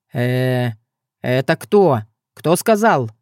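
low hesitant start, a touch of fright; mildly spooked, feeling out who spoke; startled, wary. A narrator's reading. Young man hearing a voice in an empty room, startled. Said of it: "струхнул Илья".

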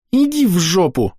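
put-on high, rude little girl snap; snapping child. A narrator's reading. Girl snaps at Gil from the jacket, high and rude. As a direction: snap it back defiantly. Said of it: "огрызается Амелия".

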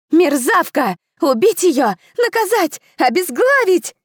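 high, loud indignant shouting; outraged crowd cries. A narrator's reading. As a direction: shout for her death, outraged. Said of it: "возмущённые восклики".

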